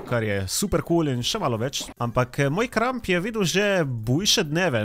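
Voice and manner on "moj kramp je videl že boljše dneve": slow, higher, drawn-out mock sorrow; mock lament. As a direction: mourn the worn pickaxe theatrically. Lamenting worn pickaxe.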